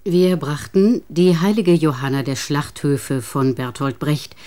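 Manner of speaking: normal talking voice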